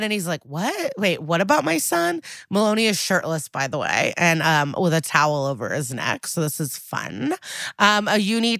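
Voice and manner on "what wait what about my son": high, startled retold question; mock bewilderment. Retelling father hearing about son, mock bewilderment. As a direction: ask it higher, as if blindsided.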